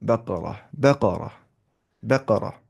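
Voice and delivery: "बकरा" repeated, falling onto final h; pausal ending. Shown as a demonstration. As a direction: stop on "बकरा" as bakarah, no tun. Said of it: "अगर हम pause दें, क्या पढ़ेंगे?".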